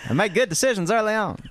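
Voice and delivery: put-on high, laughing old-timer brag; 1940s old-timer voice. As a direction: play a boastful 1940s retiree. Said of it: "dial up your nineteen forties voice".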